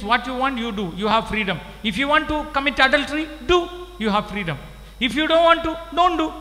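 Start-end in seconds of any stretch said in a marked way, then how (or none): 5.0-6.3 emphatic. Real, not acted. Preacher hammering a point.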